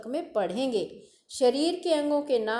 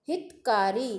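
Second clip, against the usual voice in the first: ordinary delivery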